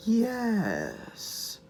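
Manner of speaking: very soft, drawn-out villain's "yes"; half-hearted attempt at a cartoon villain voice, hushed; Beast Wars Megatron. Reviewer tries the Megatron voice he says he can't do, hushed impression. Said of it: "I can't do the voice".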